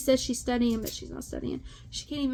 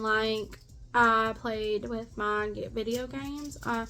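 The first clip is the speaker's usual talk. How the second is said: mocking the daughter; 5 dB louder than usual. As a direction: ham up a vacant teen rambling to mock her. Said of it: "this is how she sounds".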